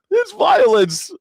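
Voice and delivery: very high, loud, slow shriek; mock outrage. Mocking MAGA outrage at pool, shrill slow shriek. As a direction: shriek it in fake horror.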